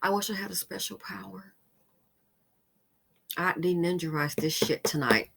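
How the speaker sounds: ordinary talk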